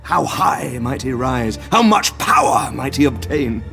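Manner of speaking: slow, swelling grandiose questions; power-hungry rapture. Acted. Doctor dreaming aloud of the books' power, rapt and grandiose. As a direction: build hungrily towards the word power.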